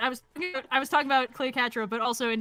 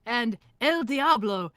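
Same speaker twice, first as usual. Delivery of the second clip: normal talking voice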